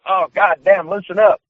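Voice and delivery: high-pitched imitated protest; retold pained complaint. Opponent whose arm is grabbed too hard, imitated complaint. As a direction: plead with someone gripping too hard.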